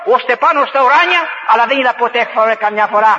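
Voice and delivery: ordinary talk, nothing marked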